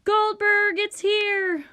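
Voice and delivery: high, loud announcement; excited calling out. A narrator's reading. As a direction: shout the news across the house. Letter has finally arrived.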